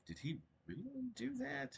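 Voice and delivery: high, slow, incredulous reader's voice; mock-incredulous question; puzzled reader. Imagined reader puzzling over juxtaposition.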